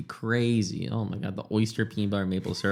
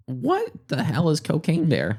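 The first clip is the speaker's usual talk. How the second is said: incredulous; 6 semitones higher, slower than usual. Real, not acted. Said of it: "I am so glad you asked".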